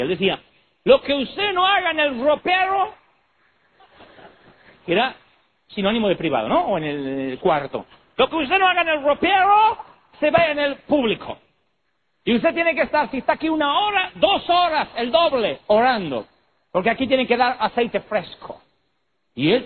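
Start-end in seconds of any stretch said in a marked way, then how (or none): 0.8-2.9 very high, slow, drawn-out preacher; German pastor impression. Old pastor exhorting his musicians, hammed-up preacher impression. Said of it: "consecuente a lo que él decía". 8.2-18.6 high-pitched hammed-up exhorting preacher; German pastor impression. Old pastor demanding hours of prayer, mimicked high-pitched preaching. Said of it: "consecuente a lo que él decía".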